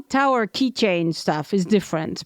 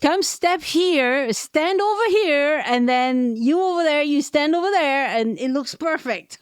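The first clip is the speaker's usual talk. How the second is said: helpful photo posing guide; 4 semitones higher than usual. Retold or imitated. Keychain seller arranging tourists for Eiffel Tower shots, helpful guiding. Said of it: "He will model how to do it".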